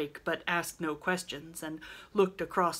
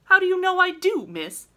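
indignant; 13 dB louder than usual. Acted. Laurie indignant at accusation.

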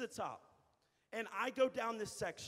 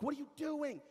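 retold yell; 6 semitones higher than usual. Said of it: "I yelled at him".

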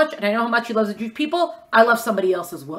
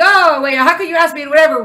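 indignant surprise; 7 semitones higher, 9 dB louder than usual. Acted. Acted indignant objection.

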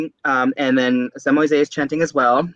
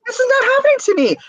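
very high, rushed mock alarm; retold panic; faster than usual. Telling friend how the table was shaking, mock panic. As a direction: race through it high, in comic denial.

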